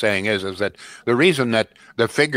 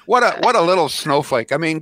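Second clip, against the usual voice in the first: ordinary delivery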